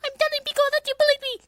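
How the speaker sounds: put-on very high, fast whiny girl voice; Miku voice. Miku tattling to Pico, very high and fast. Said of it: "This is a voice acting stream".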